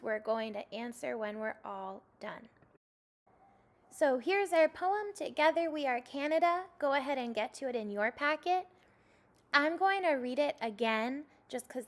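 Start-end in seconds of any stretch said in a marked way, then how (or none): none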